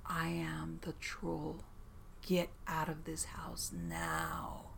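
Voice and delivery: slow, hoarse whispered threat; raspy troll whisper. Retold or imitated. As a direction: breathe it out like an evil troll. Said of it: "we heard a strange, raspy voice".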